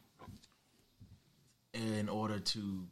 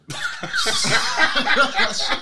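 loud burst of laughter; 15 dB louder than usual. Real, not acted. Laughing at his own admission.

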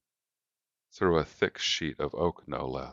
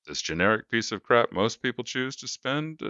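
salesman voice; 6 semitones higher than usual. A narrator's reading. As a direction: sell it like a slick salesman. Salesman voice again.

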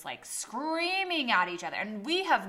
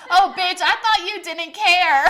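very loud, high mock outrage; gleeful call-out; 12 semitones higher, 16 dB louder than usual. She calls out friend who claimed indifference, loud laughing mock outrage. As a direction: shout it through laughter.

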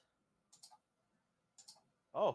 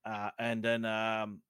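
ordinary talk, nothing marked

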